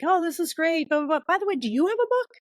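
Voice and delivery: high, enthusiastic retold chatter; gushing audience member. Audience to speaker after her talk, gushing.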